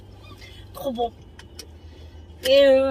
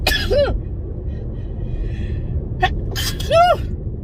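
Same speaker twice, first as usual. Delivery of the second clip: sneeze. Real, not acted. Sneeze just announced.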